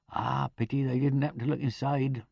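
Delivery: ordinary delivery, nothing marked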